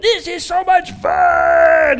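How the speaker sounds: high, loud, drawn-out whoop; gleeful kid shout. Retold or imitated. Son to his buddy on the field, gleeful shouting. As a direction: imitate an overjoyed child yelling as he runs.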